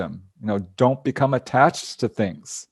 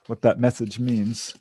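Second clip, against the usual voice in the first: normal talking voice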